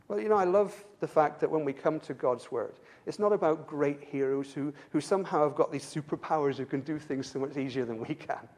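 ordinary talk, nothing marked

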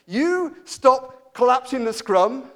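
loud, slow referee's telling-off; Nigel Owens. Retold or imitated. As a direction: scold big players like little kids, high and emphatic. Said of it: "telling them off like they were little kids".

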